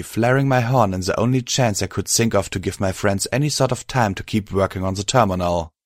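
plain reading voice

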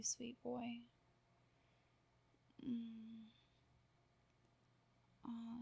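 normal talking voice

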